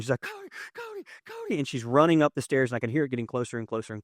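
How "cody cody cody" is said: high, hushed falsetto mock scream; imitated scream. Wife calling husband as she runs upstairs, mock screaming. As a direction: mimic distant frantic cries, very high and soft. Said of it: "And she's screaming".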